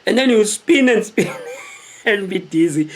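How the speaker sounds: laughing through the words. Real, not acted. Telling viewers about the dizzy spin, laughing. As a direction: laugh as you tell it.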